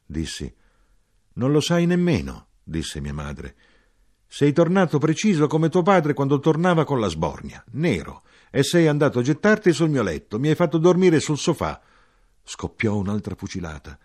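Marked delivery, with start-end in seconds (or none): 1.3-2.5 high, louder reproach; scolding mother. Acted. Mother scolding her son about his drunkenness, loud. 4.3-11.8 high, louder reproach; scolding mother. Acted. Mother scolding her son about his drunkenness, loud.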